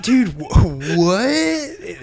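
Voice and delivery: rising shocked what; stunned what. Retold or imitated. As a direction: caught off guard, pitching up.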